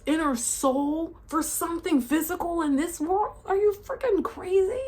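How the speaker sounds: high, incredulous outrage; outraged disbelief. Real, not acted. Condemning abusers to the camera, incredulous high voice. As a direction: demand an answer, appalled.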